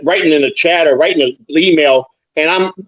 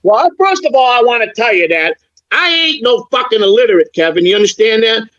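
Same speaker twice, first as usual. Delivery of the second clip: pitched up, indignant role-play rant; heated comeback; 7 semitones higher than usual. Pretend. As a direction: fire back at the insult, voice climbing high. Role-play argument with Kevin.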